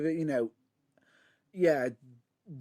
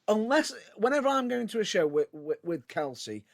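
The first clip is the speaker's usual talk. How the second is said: normal talking voice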